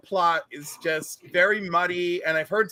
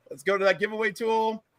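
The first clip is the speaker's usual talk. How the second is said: ordinary talk, nothing marked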